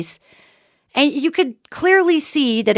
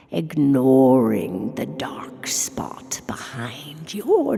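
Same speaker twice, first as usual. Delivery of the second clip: slow, low, creepy whispered warning; spooky whisper; 7 semitones lower, 4 dB quieter, much slower than usual. Acted. Host whispers a creepy goodnight to listeners, slow and low. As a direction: hush it out like a ghost story at bedtime.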